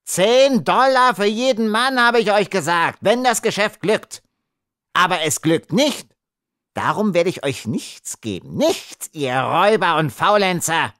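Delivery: very high-pitched, outraged screech. A narrator's reading. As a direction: shriek your refusal at the robbers. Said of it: "kreischte er".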